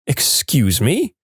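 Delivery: slow, rising "Excuse me?" like a shocked teacher; stern excuse me. Retold or imitated. Co-teacher stopping an inappropriate story, slow rising question. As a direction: draw out "Excuse me?" in mild reproach. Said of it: "My co-teacher says".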